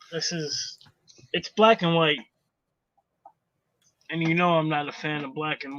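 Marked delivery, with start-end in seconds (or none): 0.1-0.8 whisper. Real, not acted. Whispered aside showing the art.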